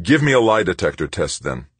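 hard challenge held back in anger; simmering anger. A narrator's reading. Angry Tavalera demanding a lie detector test. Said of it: "Leaning his knuckles on the desktop".

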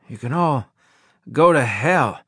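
rough, raspy growl of a man just revived. A narrator's reading. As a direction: rasp the words out hoarsely. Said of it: "I said in a raspy voice".

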